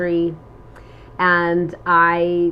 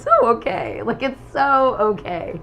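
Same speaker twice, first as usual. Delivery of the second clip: reassuring; 3 semitones higher than usual. Retold or imitated. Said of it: "I was like".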